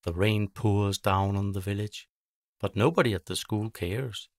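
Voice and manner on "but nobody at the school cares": usual speaking voice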